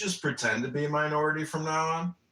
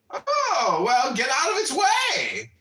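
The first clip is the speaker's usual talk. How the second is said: much higher, loud call; shouting companion; 13 semitones higher, 6 dB louder, slower than usual. Acted. Companion shouting back at sleeper, loud call. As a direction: yell at him to move. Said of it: "asked him".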